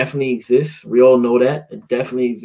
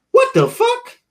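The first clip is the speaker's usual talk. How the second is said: very high-pitched imitation of a small child; little kid voice; slower than usual. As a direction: mimic your little son in a tiny high voice. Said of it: "with the little ass kid voice".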